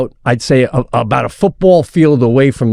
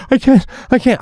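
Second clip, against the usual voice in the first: high, pleading mock panic; imitated child panic; 11 semitones higher than usual. Daughter tells dad she cannot go on, imitated high-pitched panic. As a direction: play a frightened kid refusing, pitched way up. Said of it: "she starts to panic".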